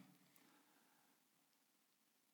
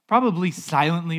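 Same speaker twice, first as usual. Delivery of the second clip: ordinary delivery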